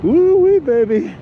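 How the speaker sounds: high, loud joyful whoop; excited whoop. Real, not acted. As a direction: whoop it out with glee. Cheering the south wind.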